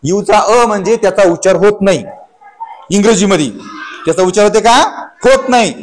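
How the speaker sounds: ordinary talk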